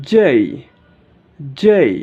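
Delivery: "জেই" loud, gliding from e to i; corrected letter J. Shown as a demonstration. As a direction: punch "জেই" as jay and let it fall. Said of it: "সঠিক উচ্চারণ হবে". ("জেই" জোরে, শেষে ই টেনে)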